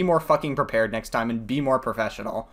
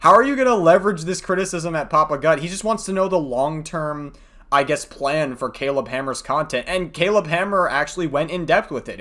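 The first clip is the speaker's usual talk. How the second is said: ordinary talk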